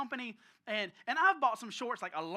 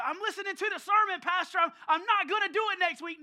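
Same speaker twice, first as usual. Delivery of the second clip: high, loud, eager declaration; acting out overconfident resolve; zealous vow; 7 semitones higher, 9 dB louder than usual. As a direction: proclaim it like a fired-up churchgoer.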